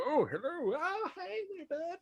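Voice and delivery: squeaky high looking-up hello; very high satyr greeting. Acted. Tiny satyr greeting the seven-foot Athos, squeaky high. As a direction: chirp it up at the giant. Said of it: "just go".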